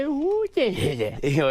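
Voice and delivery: high-pitched goofy giggle; squeaky silly laugh, a different voice; Sandler high giggle. Retold or imitated. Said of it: "he does the thing where".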